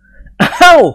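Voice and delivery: high, loud drawn-out "อ้าว"; loud cry. Acted. Pol crying out when exposed by the machine, loud. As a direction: cry out caught off guard. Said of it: "ร้องออกมาดังๆ".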